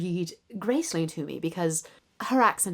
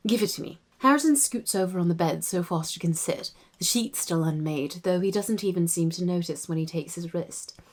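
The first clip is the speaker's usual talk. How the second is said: soft, measured RP narration; RP accent; 4 dB quieter than usual. Shown as a demonstration. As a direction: read it quietly in an RP English accent. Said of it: "[name] does the R P accent".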